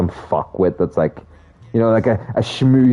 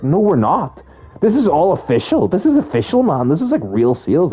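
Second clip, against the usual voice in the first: defensive colleague; 6 semitones higher than usual. Retold or imitated. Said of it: "they'd be like".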